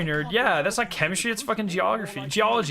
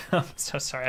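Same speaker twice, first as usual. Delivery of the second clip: ordinary talk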